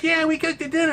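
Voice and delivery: lightly voiced proud women; a hint of cheerful wives answering; mock women's reply. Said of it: "they're like".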